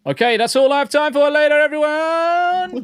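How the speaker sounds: high, loud, rushed call, last word stretched out; mock sign-off shout. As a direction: belt out a hurried joke goodbye to the crowd. Mock sign-off called out to viewers.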